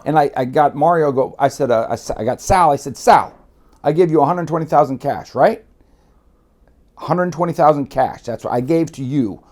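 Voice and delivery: loud, high heated ranting; heated telling. Retold or imitated. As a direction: hammer the cash point home, loud and hot. Confronting Sal over cash.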